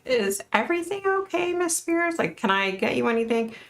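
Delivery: very high, careful little voice; put-on high, over-polite asking; timid check-in.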